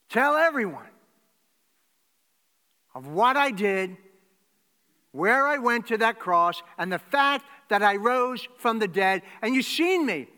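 loud, high, driving sermon voice; preaching at full force. Acted. Preacher to congregation, the risen Jesus greeting them, loudly. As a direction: proclaim it to the back row.

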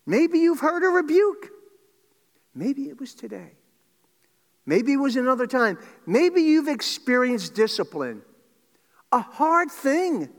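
loud, high-pitched exhortation; full-force preaching. Real, not acted. Preacher challenging listeners about rebuke and discipline, impassioned preaching. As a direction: press each "maybe" home, high and forceful.

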